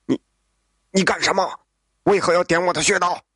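alarmed, stammering, speeding up; a frozen man blurting out in alarm; panicked. Acted. Father frozen by a pressure point, asking his son-in-law in panic. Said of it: "惊慌地说".

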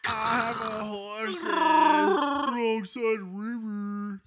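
high, slow shouted character voice; loud silly voice. Pretend. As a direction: shout it out slowly in a goofy voice.

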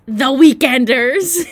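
slow, loud, drawn-out announcement; announcing a name. Retold or imitated. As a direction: proclaim it like a grand title. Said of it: "we were both like".

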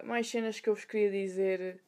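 ordinary delivery

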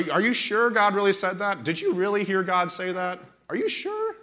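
preacher lightly voicing the serpent questioning Eve; taunting devil. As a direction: needle her with doubt, climbing to a high "sure".

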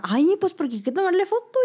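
mom's voice. Retold or imitated. Mother quoted in story.

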